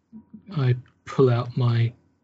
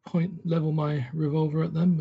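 ordinary talk

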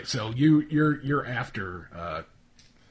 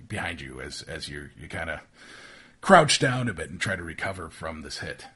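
usual speaking voice

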